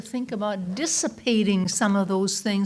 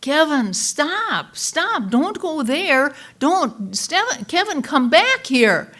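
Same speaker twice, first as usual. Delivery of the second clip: put-on high, urgent yell after a runaway child; acted-out grandmother yelling, pitched up and loud; 7 semitones higher, 5 dB louder, slower than usual. Mother watching little Kevin head for the main road, yelling. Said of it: "she yells".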